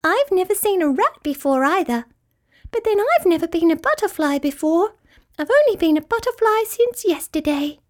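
high, tinkly, cheerful voice; dainty high-pitched forgiveness; happy butterfly. A narrator's reading. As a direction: chatter happily as a tiny butterfly. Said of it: "said Butterfly happily".

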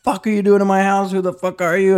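high, indignant demand at an intruder; yelled challenge, re-enacted; retold screaming. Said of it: "I'm just screaming at him".

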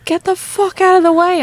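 angry driver yelling; road rage yell. Retold or imitated. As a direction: bark it like a furious driver. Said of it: "Danny's fucking road rage".

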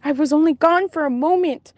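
high, agitated questioning pitched well up; stunned disbelief. Retold or imitated. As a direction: frantically work out how it happened. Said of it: "and I just was like".

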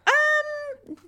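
high, drawn-out awkward "um"; awkward stall. Retold or imitated. Woman retelling her blunder to her friend, awkward high stall. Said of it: "I go".

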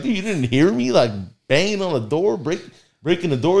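high, incredulous questioning; can't believe he slept through it; disbelief. Retold or imitated. Disbelief friend heard nothing.

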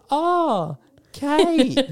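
very high, loud sing-out; hammed-up high-pitched "found you" call; mock villain greeting. Co-host imitating the villains catching runaway Kate, mock greeting.

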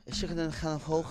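put-on Irish accent with throaty, scraped k sounds; mock Irish accent. Repeating it for a doubting friend, mock Irish accent. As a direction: put on a comic Irish brogue. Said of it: "my Irish accent was on point".

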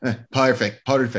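exaggerated Scottish vowels on "perfect"; mock Scottish accent. Bandmates trade Simon's favourite word in mock Scottish accents. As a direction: try "perfect" like a Scotsman. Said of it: "the accent".

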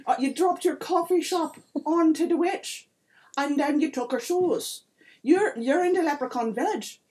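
put-on high-pitched, loud accented leprechaun voice; put-on lilting little-folk voice; accusing leprechaun. Leprechaun explains to stranger.

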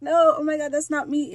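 high, loud panicked denial in imitation; mock scream. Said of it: "starts screaming like".

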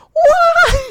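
high, loud excited yelp; a squeal of delight; excited what. Retold or imitated. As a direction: leap at the chance with glee. Delighted at chance to cure.

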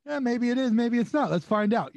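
high, laughing tease; mock coy, through laughter; teasing laughter. Friend teasing the speaker about the call, laughing. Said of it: "he gives me the".

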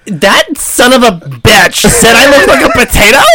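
loud, high offended yell; indignant outrage. Acted. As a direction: shout it like an insulted reviewer. Offended reviewer voiced.

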